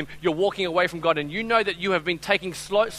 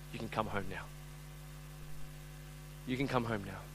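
soft pleading invitation; 8 semitones lower, 14 dB quieter than usual. Real, not acted. Altar call appeal.

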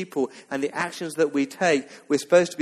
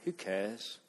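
much softer, lower, bored shrug of a line; mock apathy; 4 semitones lower, 14 dB quieter than usual. Preacher voicing an indifferent listener, soft bored shrug. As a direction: toss it off like you couldn't care less. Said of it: "there'll be some apathy here".

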